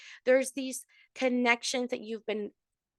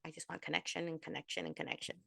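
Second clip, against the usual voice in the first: soft, fast, lower murmured wish; quiet wishful murmur; 6 semitones lower, 14 dB quieter, faster than usual. Pretend. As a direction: murmur it like a private longing. Voicing the viewers' wish.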